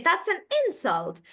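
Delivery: IN of insult pitched high and stressed. Shown as a demonstration. Teacher giving an example sentence, stress on IN in insult. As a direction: insult as a noun, front syllable punched. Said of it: "So I'm stressing on in".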